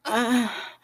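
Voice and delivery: high sudden gasp of pleasure; a quick loud cry of pleasure; sharp moan. Acted. Climax beginning in story.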